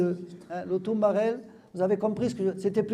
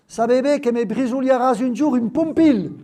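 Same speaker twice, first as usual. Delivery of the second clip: Occitan sentence read out, louder; lecture en occitan; 4 semitones higher, 7 dB louder than usual. Acted. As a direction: read the patois line for the class. Lit un texte occitan. (lecture appuyée en occitan)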